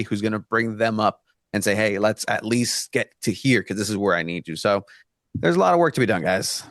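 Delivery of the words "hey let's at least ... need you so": ordinary delivery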